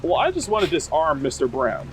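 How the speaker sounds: normal talking voice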